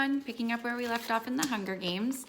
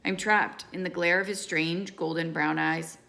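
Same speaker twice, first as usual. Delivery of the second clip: ordinary delivery, nothing marked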